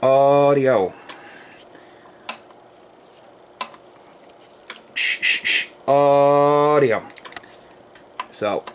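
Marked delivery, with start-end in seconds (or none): 0.0-7.0 lone drawn-out "Audio" into the mic; key up and call once; radio test call. Real, not acted. Repairman keying the amp, single radio test call.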